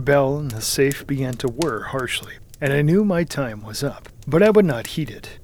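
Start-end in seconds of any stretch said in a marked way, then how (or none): none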